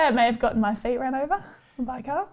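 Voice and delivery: usual speaking voice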